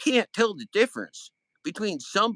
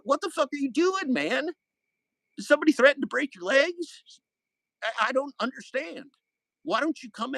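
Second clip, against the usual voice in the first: rising, much higher furious yelling; angry outburst; 8 semitones higher than usual. Real, not acted. To the former friend who spread lies, angry high yell. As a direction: lay into him, voice climbing high.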